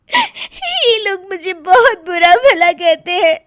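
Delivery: slow, tearful complaint through sobs; crying. Acted. Daughter crying to her mother's ghost, sobbing. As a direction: pour out the hurt while weeping. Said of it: "फूट फूट कर रोने लगती है।".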